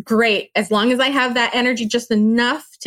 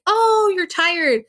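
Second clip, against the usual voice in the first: mocking know-it-all; 8 semitones higher, much slower than usual. Mimicking advice-givers, high, slow.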